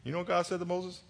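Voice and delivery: ordinary delivery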